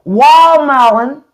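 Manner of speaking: WALL MELON, slow and high, the T gone from watermelon; stretched country "wall melon"; Southern watermelon. Shown as a demonstration. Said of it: "It's hard for me to say that one".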